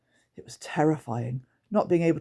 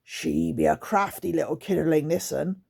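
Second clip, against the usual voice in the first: 1 growled. Acted. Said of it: "Grunt growled".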